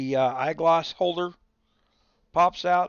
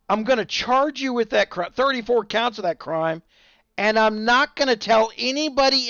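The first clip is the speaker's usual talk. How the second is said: higher and louder sneering prosecutor voice; mock scumbag prosecutor; 6 semitones higher, 5 dB louder than usual. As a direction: ham up a smug prosecutor gloating over the defendant.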